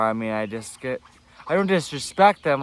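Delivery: normal talking voice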